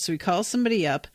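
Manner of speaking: ordinary delivery, nothing marked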